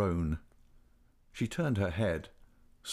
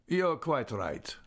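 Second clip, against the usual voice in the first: slow, higher-pitched doctor; doctor's voice; 5 semitones higher, slower than usual. A narrator's reading. Doctor agreeing with the young man, slow character voice. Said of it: "said the doctor".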